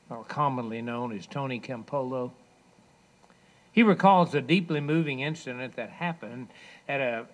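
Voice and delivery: ordinary talk, nothing marked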